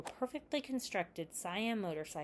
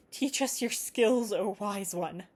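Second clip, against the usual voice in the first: slow, louder and higher mock-reverent plea; eager plea; 4 semitones higher, 8 dB louder, slower than usual. A narrator's reading. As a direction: beg the master with hammed-up eagerness. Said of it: "said Jay eagerly".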